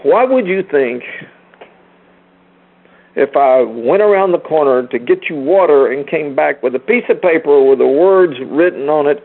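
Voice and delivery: normal talking voice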